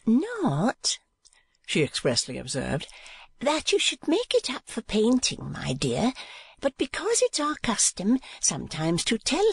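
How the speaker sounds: high-voiced chattering portrait painter; Miss La Creevy. A narrator's reading. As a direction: lift into a high, prim, gossipy voice.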